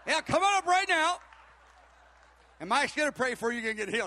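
loud, high preacher calling people forward; rousing altar-call holler, imitated; Bob Jones. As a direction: announce it big, building to a shouted summons. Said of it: "got up on the microphone and said".

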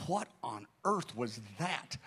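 low, slow, hushed astonishment; stunned disbelief. Retold or imitated. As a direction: mutter it slowly, too stunned to speak up. Pastor stunned by woman's reaction.

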